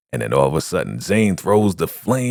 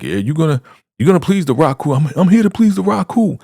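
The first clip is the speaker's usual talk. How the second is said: high, loud mock begging; 9 semitones higher, 4 dB louder, faster than usual. Condemned man promising to please the Raku, high-pitched begging. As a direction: beg in a climbing high voice. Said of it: "And he's begging, pleading".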